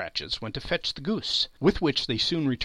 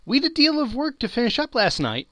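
put-on higher, louder young girl's voice; a daughter explaining herself to her mother; lightly voiced girl; 11 semitones higher, 5 dB louder than usual. A narrator's reading. Daughter excusing her lateness, high girlish voice. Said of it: "replied the girl".

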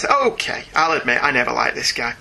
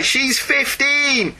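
she's fifteen drawn out slowly and high; emphatic; 11 semitones higher, much slower than usual. Retold or imitated. Reading the listener's outrage, slow and pointed. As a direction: lean on every syllable of she's fifteen in disbelief.

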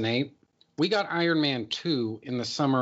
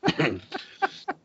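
cough; 3 semitones higher than usual. Real, not acted. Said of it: "went down the wrong pipe".

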